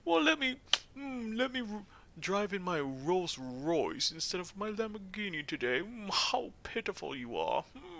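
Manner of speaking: high-pitched, hammed-up British snob; British highbrow. Player imitating smug Snake as rich snob, British highbrow accent. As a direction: look down on everyone in mock posh British. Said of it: "that kind of voice".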